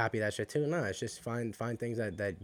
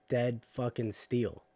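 slow emphatic; much slower than usual. Real, not acted. Emphatic gym description.

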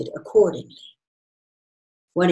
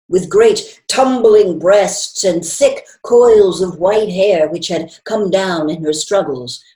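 plain reading voice

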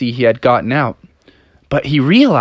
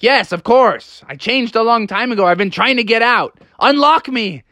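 put-on higher, exasperated kid voice; impatient young Froggy; 9 semitones higher than usual. Froggy answers the janitor through the door, impatient. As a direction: plead impatiently to be let out. Trapped frog demands release.

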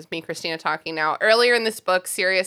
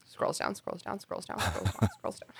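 fading mutter; 10 semitones lower, 12 dB quieter than usual. Pretend. Fading narrated action.

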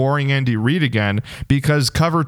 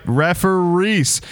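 slow stretched name, then higher call; hype man intro; 6 semitones higher, slower than usual. Acted. Host hyping up his co-host for the review.

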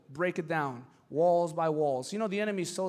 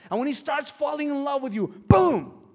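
building excitement; 7 semitones higher, 7 dB louder than usual. Retold or imitated. Psychologist's plan climax.

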